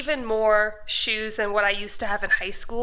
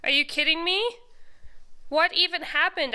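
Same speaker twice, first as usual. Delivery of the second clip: higher, louder astonished questions; incredulous, pitched-up outburst; exasperated disbelief; 7 semitones higher, 3 dB louder than usual. Real, not acted. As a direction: react aghast at the sheer amount. Aghast at her huge shoe pile.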